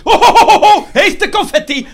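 high, loud hammed-up ho ho; comic stop shout; mock alarm. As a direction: call a halt in fake panic. Mock alarm, calling halt.

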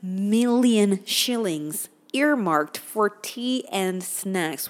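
slow, deliberate disbelieving recap; slow incredulous. Acted. Vlogger slowly repeating absurd budget item in disbelief.

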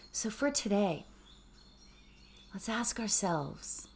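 soft, calm meditation voice, sometimes whispered; hushed gratitude meditation; guided meditation. Acted. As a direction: ease listeners inward with gentle questions.

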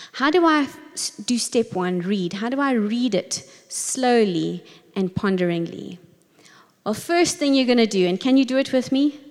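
normal talking voice

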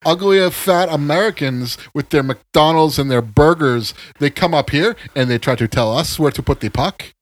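a hammed-up French Canadian hockey fan raging at Americans; French Canadian accent. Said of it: "you found the French Canadian voice".

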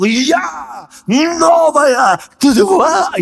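very slow, high, loud «Я новая тварь»; shout. Retold or imitated. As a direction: hold the shout long and loud. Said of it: "И я заорал".

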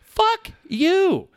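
high, loud furious yell; angry outburst. Real, not acted. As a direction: throw it straight at them.

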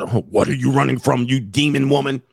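hammed-up interrogator's accusation; heavy, suspicious grilling, played for laughs; mock accusing. Shown as a demonstration. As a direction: accuse her like a mock exorcist. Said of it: "Don't say it like you're".

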